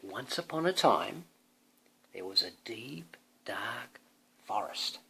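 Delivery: ordinary delivery, nothing marked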